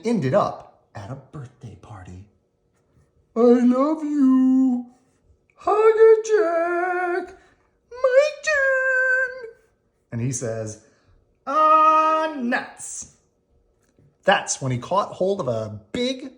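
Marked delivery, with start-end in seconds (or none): 3.3-9.5 put-on very high, slow sing-song child voice; little girl. Little girl at the party hugging squirrel, high sing-song. 11.5-13.1 high squeaky sigh; squirrel groan. Acted. Squirrel at the birthday party, high groan.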